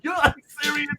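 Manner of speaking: high, laughing disbelief that breaks into a shout; mock outrage at being asked for an autograph; incredulous. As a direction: act floored that anyone would ask. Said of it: "I'm like".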